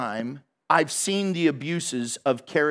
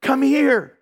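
high, loud call; calling mom; 7 semitones higher, 5 dB louder, much slower than usual. Retold or imitated. Girl calling her mother to the bedroom, high and urgent.

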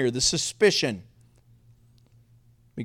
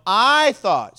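emphatic "I", pitched up; stress on "I"; 4 semitones higher, 7 dB louder than usual. Shown as a demonstration. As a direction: hit "I" hard, then fall. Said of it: "Say it with emphasis".

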